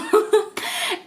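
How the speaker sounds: a quick high self-mocking laugh; short laugh. Real, not acted. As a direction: laugh at yourself. Laughs at own upbringing.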